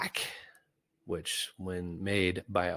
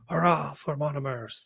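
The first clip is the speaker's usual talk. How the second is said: high, somewhat louder hurrah; raised cheer; 8 semitones higher, 4 dB louder than usual. Acted. Gumpel altering her cheer to rally the Monomers, calling out. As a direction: call it out to rally the crowd. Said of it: "Gumpel altered her words to".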